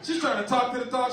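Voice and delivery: usual speaking voice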